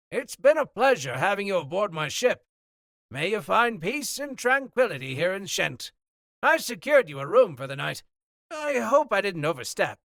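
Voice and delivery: loud, high, booming captain over the noise; hearty sea captain. Acted. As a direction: project like a jovial captain on the docks. Captain bids farewell.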